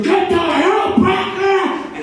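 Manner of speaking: a dad bellowing at his fleeing son, slowed right down; furious yell. Retold or imitated. Dad screaming after his son down the street, a slow, high, loud yell. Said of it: "and screaming".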